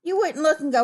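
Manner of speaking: usual speaking voice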